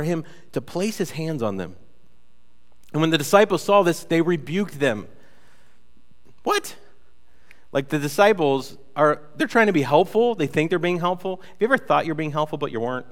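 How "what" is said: very high startled what; shocked disbelief. Acted. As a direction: feign outrage at the disciples. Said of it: "reacting to disciples rebuking".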